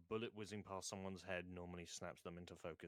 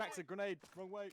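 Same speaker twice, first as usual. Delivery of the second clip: raised, urgent warning shout; grenade warning yell; 13 semitones higher, 4 dB louder than usual. Real, not acted. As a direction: shout it high and fast. Grenade thrown by mistake in-game.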